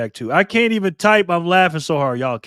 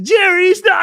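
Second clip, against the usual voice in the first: much higher, louder mock protest; imitating her; 13 semitones higher, 10 dB louder, slower than usual. Friend retelling how she scolded him, high mock voice. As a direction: play her, pitched way up, telling him off. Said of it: "And she said".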